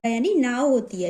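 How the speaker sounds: ordinary talk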